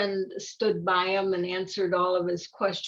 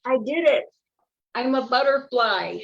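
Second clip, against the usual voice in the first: louder, higher triumphant cry; triumphant butterfly; 4 semitones higher, 5 dB louder than usual. Acted. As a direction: celebrate the change loudly.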